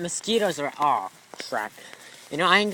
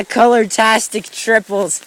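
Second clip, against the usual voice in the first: ordinary delivery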